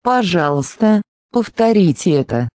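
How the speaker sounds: ordinary talk, nothing marked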